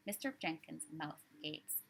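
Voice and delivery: usual speaking voice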